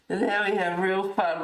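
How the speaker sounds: ordinary talk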